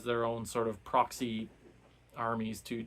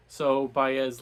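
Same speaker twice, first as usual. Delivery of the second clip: ordinary delivery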